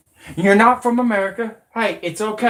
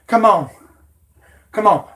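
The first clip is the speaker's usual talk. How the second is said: ordinary talk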